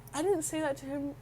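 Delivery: normal talking voice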